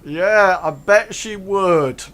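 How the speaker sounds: high, loud, drawn-out jeer; loud, slow knowing jibe; jeering ruffians. Acted. Ruffians teasing about Frankie, high loud jeer. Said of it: "they say".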